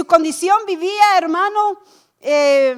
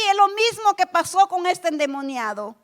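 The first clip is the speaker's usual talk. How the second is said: ordinary talk, nothing marked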